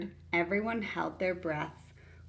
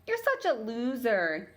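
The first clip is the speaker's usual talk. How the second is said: mock taunt; 5 semitones higher than usual. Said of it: "he clucked at Rose".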